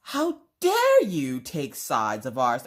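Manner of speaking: slow, drawn-out mock outrage; old-fashioned melodrama. Wife accusing husband of taking sides, old-fashioned melodrama. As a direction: declaim it like a wronged period heroine. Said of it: "talking like you're from the nineteen hundreds".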